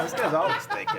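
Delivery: high mocking repeat of this guy's always thinking; mocking echo. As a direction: echo it back in a high teasing voice. Echoing the tease.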